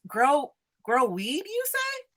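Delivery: slow, dawning curiosity rising at the end; wondering question. Acted. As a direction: repeat it slowly as a puzzled question. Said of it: "he looks at Moses and he goes".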